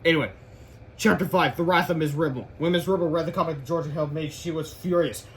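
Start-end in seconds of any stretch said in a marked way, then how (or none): none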